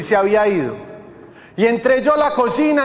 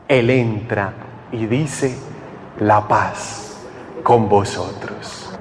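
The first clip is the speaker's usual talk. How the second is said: very low, reverent preacher's voice; deep, measured delivery with weighty pauses; solemn preaching; 8 semitones lower than usual. Acted. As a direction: bring the sermon down to a hushed gravity. Solemn sermon climax.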